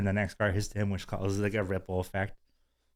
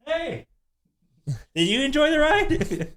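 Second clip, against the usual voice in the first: much higher, bright friendly question; chirpy small talk; 4 dB louder, slower than usual. Retold or imitated. Chatting up the riders behind them in a high chirpy voice. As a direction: cheerily greet strangers after the ride.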